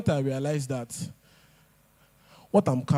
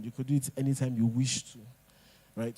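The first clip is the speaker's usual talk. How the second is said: usual speaking voice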